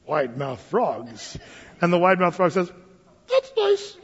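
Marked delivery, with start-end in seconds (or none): 0.1-1.4 low, slow, soft gator voice; alligator. Acted. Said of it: "the alligator says". 3.3-4.0 very high, tight little frog voice; suddenly tiny-mouthed frog punchline; mouth barely open. Acted. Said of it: "he didn't open his mouth very wide".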